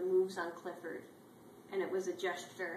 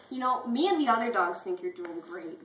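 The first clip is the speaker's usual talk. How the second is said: loud encouragement; 7 dB louder than usual. Acted. Clifford encourages T-Bone.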